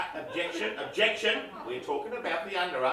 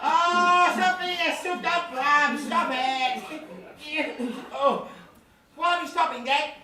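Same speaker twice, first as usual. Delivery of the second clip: high-voiced kid begging off; protesting young boy; 10 semitones higher, 4 dB louder, slower than usual. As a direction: squirm and protest in a little boy's voice. Boy protests in back seat.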